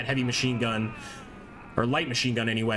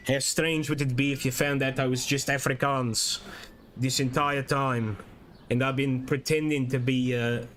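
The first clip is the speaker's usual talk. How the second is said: put-on South African accent. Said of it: "I was trying to improve my South African accent".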